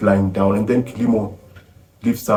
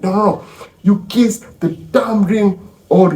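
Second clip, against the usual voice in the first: commanding anger; 10 semitones higher, 3 dB louder than usual. Retold or imitated. Mother orders sons to kiss the ring, high angry command. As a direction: hammer out the ultimatum.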